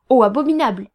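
loud, indignant royal grumble; growled disgust. A narrator's reading. Royal highness appalled by the nose, loud growled disgust. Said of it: "grogna son Altesse royale".

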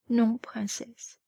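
whispered, slow and breathy with tears; chuchoté. Acted. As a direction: breathe it out softly, slowly. Bourrienne en pleurs, chuchote. (murmure lent et soufflé)

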